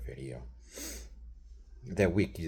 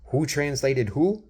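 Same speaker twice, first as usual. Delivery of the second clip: higher, louder exasperated disbelief; incredulous rant; 6 semitones higher, 7 dB louder than usual. Retold or imitated. Arguing with his wife about translating, loud incredulous voice. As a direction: build to an outraged question. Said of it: "I'm thinking".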